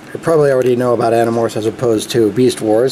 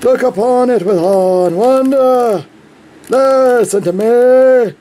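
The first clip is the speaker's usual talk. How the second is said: pompous alien warning; 7 semitones higher, slower than usual. Pretend. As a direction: grandly warn the child of doom. Tobias voice bit again.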